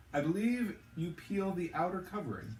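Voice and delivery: a hint of a dry, mannered gentleman; lightly voiced Silas. A narrator's reading.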